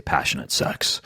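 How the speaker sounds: low, soft sultry announcer voice. Real, not acted. Podcast host repeating his sultry phrase, low movie-trailer voice. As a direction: drop low and quiet, movie-trailer style. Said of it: "I even lower my voice as I say".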